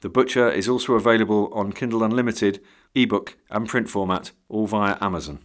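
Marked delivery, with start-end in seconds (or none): none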